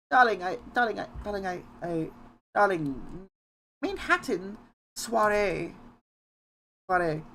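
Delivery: high, prim transatlantic voice; Rarity. Acted. Streamer rehearsing lines for a puppet, high prim transatlantic voice. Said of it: "lines to say in the Rarity voice".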